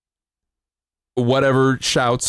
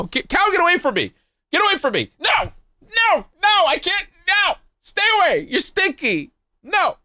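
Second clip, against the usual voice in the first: fear turning to anger. Real, not acted. Fending off an attacking cow.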